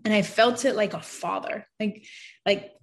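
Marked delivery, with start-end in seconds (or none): none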